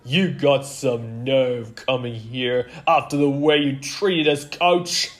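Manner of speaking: loud, indignant snap; snapped. A narrator's reading. Player resenting how coach treated them, snapping. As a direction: snap it, rising to the end. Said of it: "snapped a player".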